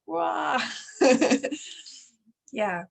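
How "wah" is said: high-pitched playful "wah"; mock eager wail. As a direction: cry out like bursting energy, playfully. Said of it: "They're like".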